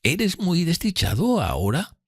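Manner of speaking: high, slow, tender question; sympathetic tone. A narrator's reading. Miss Havisham asking after Pip's unhappiness, sympathetic. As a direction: ask gently and slowly, pitched high. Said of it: "con un tono de simpatía".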